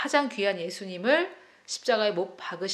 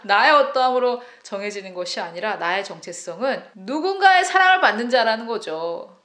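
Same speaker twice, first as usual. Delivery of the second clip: louder, pressing the point home; emphatic preaching; 8 dB louder than usual. Real, not acted. Preacher stressing identity to the congregation, loud and emphatic. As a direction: preach it with force.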